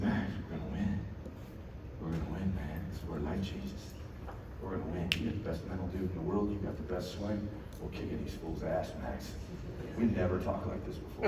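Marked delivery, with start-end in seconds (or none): 0.0-9.3 low, soft pep talk; quietly will him to believe; hushed intensity. Retold or imitated. Dad confiding to son that they will win, hushed intensity.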